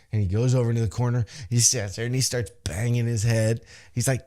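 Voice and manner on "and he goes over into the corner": ordinary delivery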